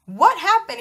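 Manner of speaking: startled mom demanding answers; alarmed question. Retold or imitated. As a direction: blurt it out in alarm. Mom alarmed at son's face.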